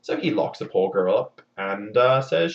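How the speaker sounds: ordinary talk, nothing marked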